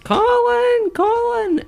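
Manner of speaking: very high, far-off calling voice of his mum. Retold or imitated. Storyteller voicing his mum calling out to him. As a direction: call his name like a mum hidden in the dark.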